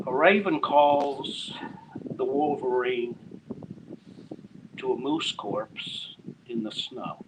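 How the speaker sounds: slow, measured poetry reading; poem reading. Acted. Poet reading his short nature poem to the open mic crowd, slow and measured. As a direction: let each image land slowly.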